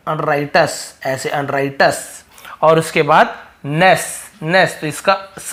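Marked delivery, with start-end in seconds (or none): 0.1-2.2 अनराइटस drawn out slowly, the final s clearly sounded; slow, careful teacher's अनराइटस; slow pronunciation demo. Shown as a demonstration. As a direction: model अनराइटस slowly for the class. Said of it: "श ध्वनि निकलता है इसका".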